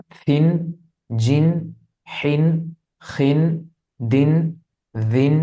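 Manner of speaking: slow letter-by-letter drill, each syllable ending in a clear n; recited tanween drill. Shown as a demonstration. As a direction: recite each syllable on its own, like a Quran teacher. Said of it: "আমি জের দিয়ে সবগুলো হরফ একবার পড়ছি".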